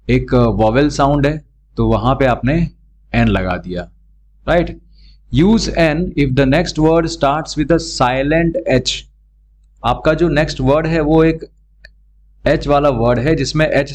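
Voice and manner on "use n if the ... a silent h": reads the rule out very slowly; slow rule reading. Shown as a demonstration. Teacher reading the grammar rule aloud slowly to students. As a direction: dictate the rule word by word.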